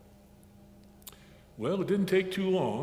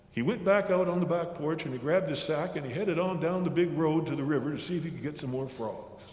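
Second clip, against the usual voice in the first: usual speaking voice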